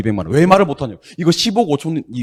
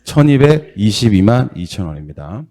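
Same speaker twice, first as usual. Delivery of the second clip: 천이백이십이만 said slowly and deliberately; careful model answer; slow, clear model reading; 4 dB louder than usual. Shown as a demonstration. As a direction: spell out 천이백이십이만 slowly for students. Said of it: "얼마라고 이거".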